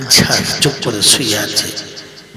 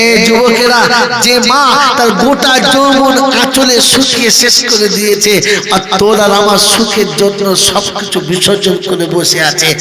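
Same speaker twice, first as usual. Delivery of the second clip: much higher, louder fiery sermon cry; impassioned preaching; 8 semitones higher, 6 dB louder than usual. Acted. Preacher cries the daughter's vow out to listeners, impassioned. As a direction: lift the voice and cry it out to the crowd.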